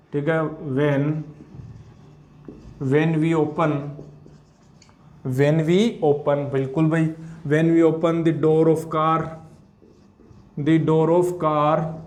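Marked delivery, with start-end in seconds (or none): 5.3-11.9 very slow, each word spaced; slow dictation. Shown as a demonstration. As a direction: dictate slowly for students to copy. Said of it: "dictating notes slowly".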